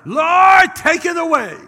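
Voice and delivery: high, very loud wailing plea imitated; yelling out. Woman at the altar begging the Lord, imitated loud yelling. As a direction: mimic a woman hollering to heaven. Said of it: "yelling out loud".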